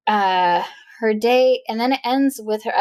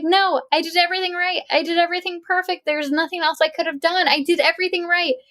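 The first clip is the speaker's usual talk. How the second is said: frantic protest; 7 semitones higher than usual. Retold or imitated. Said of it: "she was like".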